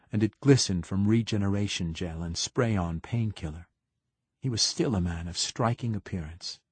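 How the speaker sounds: usual speaking voice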